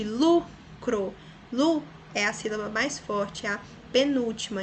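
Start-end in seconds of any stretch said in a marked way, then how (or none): 0.0-1.1 "Lucro" split as LU - CRO, first beat strong; stress on LU. Shown as a demonstration. Teacher tests the strongest syllable, stressing LU. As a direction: punch LU in "Lucro", then a separate CRO. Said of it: "Qual que é a sílaba mais forte?".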